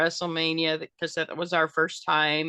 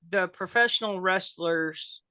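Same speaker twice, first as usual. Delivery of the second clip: ordinary talk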